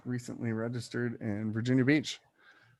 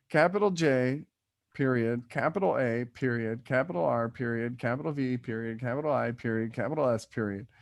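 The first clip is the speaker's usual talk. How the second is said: spelling it out letter by letter; dictating. Shown as a demonstration. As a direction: read each letter and period deliberately. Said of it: "Disney's version".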